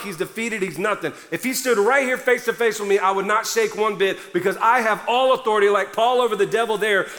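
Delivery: usual speaking voice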